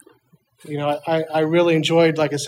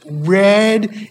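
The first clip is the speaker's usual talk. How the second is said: ordinary delivery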